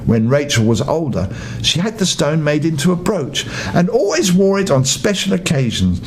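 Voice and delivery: ordinary delivery, nothing marked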